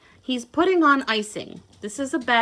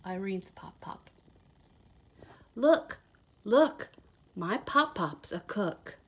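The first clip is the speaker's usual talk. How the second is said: lively storybook read-aloud; reading to children; 4 semitones lower, 5 dB quieter, slower than usual. Acted. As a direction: read it like a picture book to little kids. Reads picture book to children.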